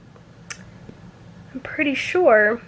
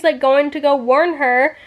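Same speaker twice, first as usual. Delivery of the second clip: ordinary talk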